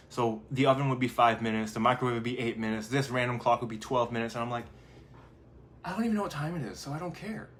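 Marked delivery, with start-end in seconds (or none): 5.8-7.5 higher, offhand don't-care voice; lightly voiced mock indifference; careless shrug. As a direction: toss it off as if nothing matters. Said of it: "I didn't consciously say".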